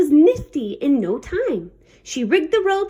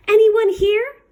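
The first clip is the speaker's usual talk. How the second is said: higher, louder call; 8 semitones higher, 4 dB louder than usual. Acted. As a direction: call into the empty ship. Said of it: "Goldenlocks called".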